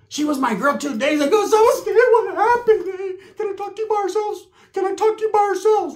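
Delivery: high squeaky crying woman voice; pleading woman. Retold or imitated. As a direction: beg in a high sobbing falsetto.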